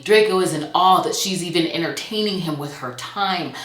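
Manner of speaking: ordinary delivery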